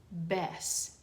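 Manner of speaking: usual speaking voice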